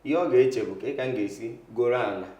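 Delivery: usual speaking voice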